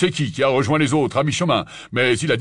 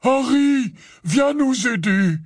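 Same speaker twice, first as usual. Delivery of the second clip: drawn-out, higher call; hoarse cry; 8 semitones higher, slower than usual. A narrator's reading. Hagrid calls Harry for help, hoarse cry. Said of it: "cria Hagrid d'une voix rauque".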